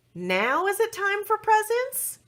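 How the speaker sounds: high eager question; Princess Euphoria voice. A narrator's reading. Euphoria asks about presents, high eager question. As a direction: ask it up high, hopeful. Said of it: "asked Princess Euphoria".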